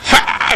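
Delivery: imitated kendo battle cry; kendo yell. Father imitating his son's kendo club cry, mock yell. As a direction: let out a mock fighter's yell. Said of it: "剣道部で".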